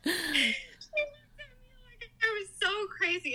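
very high, laugh-squeezed voice. Real, not acted. Mother recalls the nurse's disbelieving look, laughing. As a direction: squeal it out through laughter.

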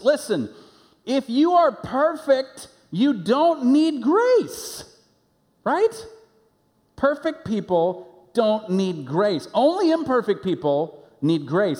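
high, loud, stretched-out preaching; preaching at full force. Acted. Pastor pressing grace on the congregation, preaching at full force. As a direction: drive home the grace point from the pulpit.

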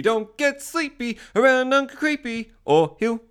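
high, loud, drawn-out sing-song warning; hammed-up creepy warning. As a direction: stretch out the rhyme in a high put-on voice. Performed rhyming sign-off catchphrase.

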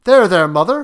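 quick, loud reassurance; calm her down in a hurry; hasty soothing. A narrator's reading. Husband hastily calming his wife, loud. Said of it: "he said hastily".

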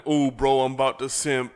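loud excited fan, half-joking; hyped commenter. Retold or imitated. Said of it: "And then".

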